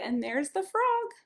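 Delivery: plain reading voice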